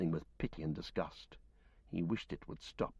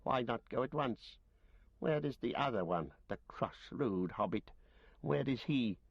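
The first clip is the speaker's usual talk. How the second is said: Gollum voice; 4 semitones higher than usual. Acted. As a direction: croon and snarl like a starved cave creature gloating over fish. Gollum questioning Frodo.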